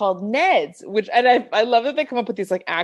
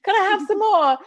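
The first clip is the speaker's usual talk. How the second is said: high-pitched pleading British orphan; playful Oliver-style British urchin; British accent; 12 semitones higher than usual. Pretend. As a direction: beg in a hammed-up British accent, laughing. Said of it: "that's my British accent".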